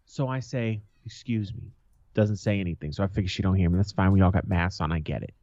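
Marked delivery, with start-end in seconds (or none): 1.0-1.7 retold excuse-me, softly under the breath; easy to miss, said softly; quiet polite request. First polite try, goes unheard.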